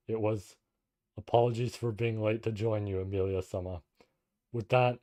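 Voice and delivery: low, measured novel reading; story narration. A narrator's reading. Reads the novel aloud.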